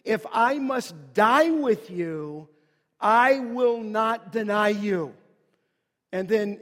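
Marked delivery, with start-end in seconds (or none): 0.0-5.1 loud, slow, insistent vow; emphatic. Retold or imitated. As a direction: drive each word home, loud and deliberate. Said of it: "it says this emphatically".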